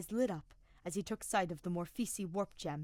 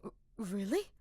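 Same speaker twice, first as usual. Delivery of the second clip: surprised; 3 semitones higher than usual. Acted. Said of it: "Ferdinand blinked in surprise".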